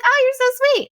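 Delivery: very high syrupy imitation of her; mock sugary voice. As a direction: coo it like a fake-nice acquaintance. Mimics the woman's reply.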